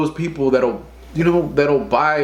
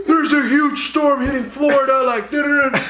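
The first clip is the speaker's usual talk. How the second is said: hyped news anchor; 10 semitones higher than usual. Retold or imitated. Mocking hyped news report.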